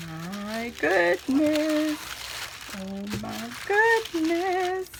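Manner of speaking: high, very slow, drawn-out wonder; amazed gasp. Real, not acted. Vlogger opening Lana's card, slow high amazement. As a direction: stretch out the awe at the crafted card.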